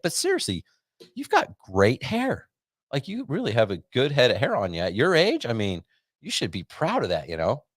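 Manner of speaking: imitating the doctor praising the patient's hair, high and gushing. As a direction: lay the flattery on thick.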